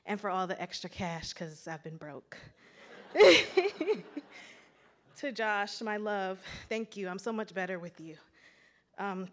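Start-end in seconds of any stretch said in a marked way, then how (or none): 2.9-4.4 loud burst of laughter; a relieved laugh at her own joke; big laugh. Real, not acted. Joking to the crowd about being broke, loud laugh.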